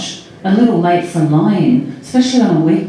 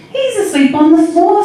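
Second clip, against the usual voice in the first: put-on high-pitched little girl; little child's voice; 12 semitones higher, 3 dB louder than usual. A narrator's reading. Little Chrissy to Sally on the phone, child's voice. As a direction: say it high and innocent, like a small child. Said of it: "said little Chrissy".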